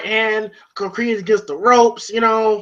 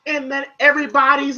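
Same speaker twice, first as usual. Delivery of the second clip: ordinary talk, nothing marked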